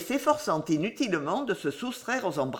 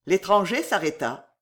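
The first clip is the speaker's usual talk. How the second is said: plain reading voice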